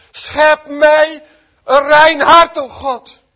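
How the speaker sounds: loud, high pleading prayer, slowing right down on the plea; David's fervent prayer. Acted. David confessing his silence to God, loud fervent prayer. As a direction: cry out to God as the penitent king. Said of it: "David zegt".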